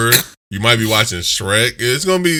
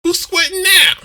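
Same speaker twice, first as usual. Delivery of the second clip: very high lisping squeal; lisping taunt; 4 dB louder than usual. Shown as a demonstration. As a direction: squeal it with a lisp. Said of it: "Say it with a lisp".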